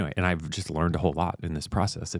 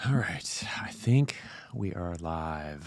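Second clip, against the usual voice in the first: hushed whisper, unsure if live; 5 dB quieter, much slower than usual. Real, not acted. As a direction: whisper it, checking the stream. Testing if stream is live.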